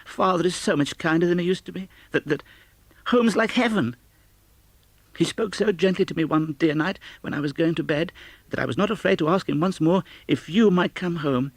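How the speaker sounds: put-on high, bright-eyed child voice, eager and joyful; a small girl bursting with happy news; child's voice lifted well above the reader's own; little Fan.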